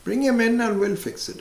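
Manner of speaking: a hammed-up confident salesman doctor; eager psychiatrist. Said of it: "So they said".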